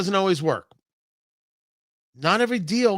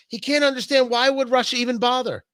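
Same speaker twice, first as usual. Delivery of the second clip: ordinary delivery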